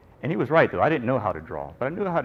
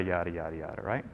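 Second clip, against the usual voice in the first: much quieter, fading yada; trailing off; 7 semitones lower, 9 dB quieter than usual. Shown as a demonstration. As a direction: let the yadas fade out.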